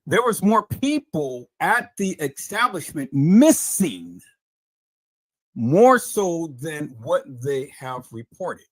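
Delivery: very slow, deliberate delivery with drawn-out key words; emphatic restatement. Real, not acted. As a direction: stretch out MISSING and spell it out for them. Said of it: "let me say this again, peoples".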